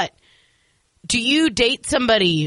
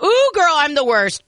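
excited; 6 semitones higher, 5 dB louder than usual. Retold or imitated. Reacting to listener's question.

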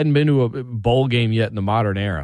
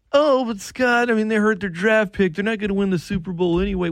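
mocking whiny fan; 9 semitones higher than usual.

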